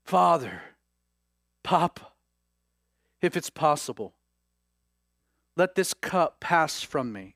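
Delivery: understated, halting plea with long pauses; sorrowful prayer. Acted. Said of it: "He's distressed and he's sorrowful and he is praying".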